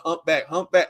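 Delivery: ordinary delivery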